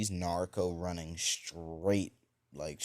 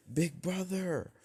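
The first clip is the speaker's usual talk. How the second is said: very high, drawn-out cry; mock little-sister squeal, slow and pitched way up; calling out; 11 semitones higher than usual. Little sister jumping on her brother, crying out.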